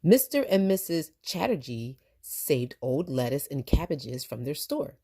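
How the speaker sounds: ordinary delivery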